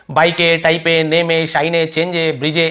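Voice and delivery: बाइक है said as "baike", टाइप है as "taipe", each final E voiced; ब्रिज है as "brije", silent E pronounced; final E sounded. Shown as a demonstration. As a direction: misread each word with an extra "ay" ending, as in बाइक है. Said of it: "ऐसे तो नहीं पढ़ रहे ना".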